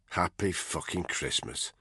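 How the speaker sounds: officer thinking sourly to himself; muttered to himself. A narrator's reading. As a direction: mutter it bitterly under your breath. Barker's bitter thought.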